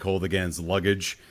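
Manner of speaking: ordinary talk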